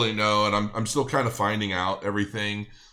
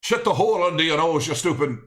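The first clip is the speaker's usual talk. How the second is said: hammed-up Scottish movie yell, higher and louder; mock Scottish Braveheart shout; 8 semitones higher, 6 dB louder than usual. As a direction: bark it like a mock Braveheart Scot. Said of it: "'cause I love Braveheart".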